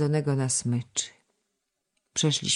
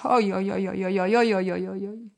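high, sobbing wail on "oj, oj, oj"; a girl howling over her lost dog; 4 semitones higher than usual. A narrator's reading. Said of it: "Ala tak beczała". (zawodzące "oj, oj, oj")

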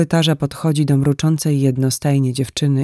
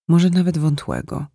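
usual speaking voice

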